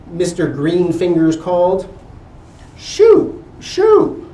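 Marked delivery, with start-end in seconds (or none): 2.8-4.3 high, loud shooing; huffy shoo. A narrator's reading. Neighbour chasing the dog off, loud huffy shoo. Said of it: "huffed Missus Hoity-toity".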